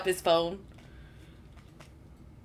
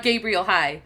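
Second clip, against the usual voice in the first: usual speaking voice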